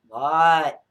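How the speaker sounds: loud, drawn-out reply; exasperated call back. Retold or imitated. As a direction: yell back like a parent called once too often. Retold answer to repeated calls.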